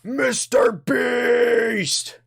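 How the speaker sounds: very high, slow stretched shout; Mister Beast impression. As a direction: ham it up as a hyped YouTuber. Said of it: "pretend to be other YouTubers".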